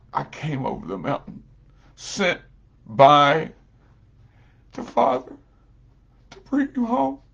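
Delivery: preacher voicing the elder brother, fervent and loud; oldest brother. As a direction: call him home with rising urgency. Oldest brother voiced in parable.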